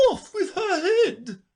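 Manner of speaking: high, slow shouted sentence; shouting. A narrator's reading. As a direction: bellow the order like a queen.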